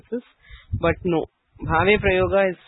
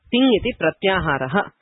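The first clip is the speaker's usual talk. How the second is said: normal talking voice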